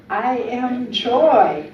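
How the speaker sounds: loud, slow affirmation. Shown as a demonstration. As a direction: declare it slowly and loudly. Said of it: "Let's affirm that together".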